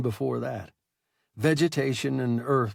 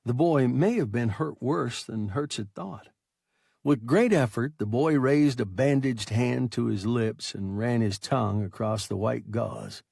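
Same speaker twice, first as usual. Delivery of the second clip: ordinary delivery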